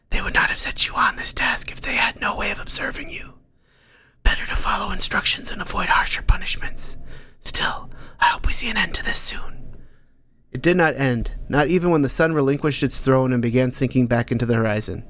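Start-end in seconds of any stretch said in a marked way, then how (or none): 0.1-9.8 breathy unvoiced whisper, close and secret. Unseen Nordstrom whispering advice to Ank. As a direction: murmur it like a hidden advisor in his head.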